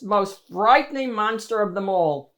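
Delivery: ordinary delivery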